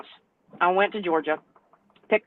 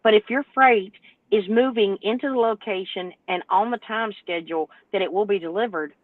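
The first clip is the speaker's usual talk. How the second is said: ordinary talk, nothing marked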